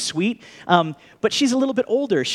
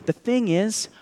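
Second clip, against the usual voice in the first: gentle Jesus; 6 semitones lower, 5 dB quieter than usual. Retold or imitated. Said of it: "'Cause Jesus is saying".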